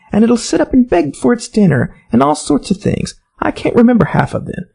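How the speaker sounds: eager chatter. A narrator's reading. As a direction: gush about the little dog. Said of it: "so Alice went on eagerly".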